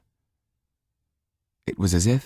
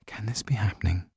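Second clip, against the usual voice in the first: breathy whisper to herself; disbelieving inner whisper; whispered thought; 4 semitones higher, 6 dB quieter than usual. A narrator's reading.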